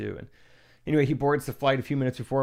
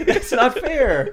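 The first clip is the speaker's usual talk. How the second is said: whiny high complaint; 12 semitones higher, 9 dB louder, much slower than usual. Retold or imitated. Mocking a whining player.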